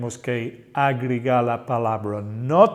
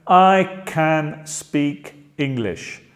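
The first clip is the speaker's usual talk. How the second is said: slow model sentence; much slower than usual. Shown as a demonstration. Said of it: "teacher models slow sentence".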